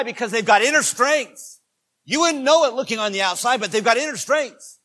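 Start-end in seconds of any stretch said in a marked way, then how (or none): none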